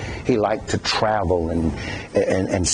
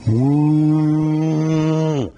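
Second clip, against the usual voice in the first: high drawn-out laugh falling away; imitated man's laugh; 5 semitones higher than usual. Preacher mimics a man he knows, drawn-out falling laugh. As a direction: ham up a man's odd laugh. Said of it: "I know a man that laughs like this".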